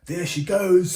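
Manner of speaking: Grumpy spotting the fleeing queen. Acted. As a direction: point and holler after her. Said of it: "said Grumpy".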